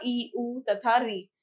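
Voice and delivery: ordinary talk, nothing marked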